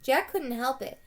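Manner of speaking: plain reading voice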